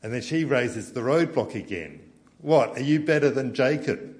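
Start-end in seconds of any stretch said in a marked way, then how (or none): 2.4-4.1 loud, high, scornful retort; indignant retort. Acted. Preacher voicing the Samaritan woman's retort to Jesus, loud and scornful. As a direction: hurl it at him with scorn. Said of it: "is her question".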